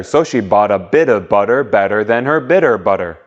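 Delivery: fast tongue twister run. Shown as a demonstration. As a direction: pick up the speed and roll through it. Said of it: "faster and then faster".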